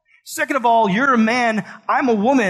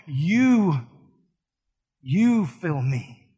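pleading; 3 semitones lower, slower than usual. Retold or imitated. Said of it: "you'd look back at me and say".

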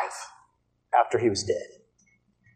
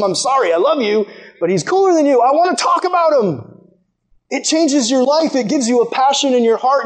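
high, fervent preacher's voice; impassioned preaching; 10 semitones higher than usual. Real, not acted. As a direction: preach it with mounting, excited insistence. Preaches at full force.

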